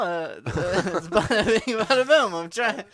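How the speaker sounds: laughing through the catchphrase; can't hold the character for laughing; breaking into laughter. Real, not acted. [name] cracking up mid-scene, laughing through his lines. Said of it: "I'm losing my Boston accent".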